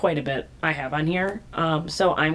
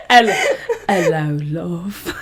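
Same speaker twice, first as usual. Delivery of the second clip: a hint of a British accent, much louder, giggling; mock British accent; 5 dB louder than usual. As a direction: say it like a cheeky Londoner. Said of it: "your British accent".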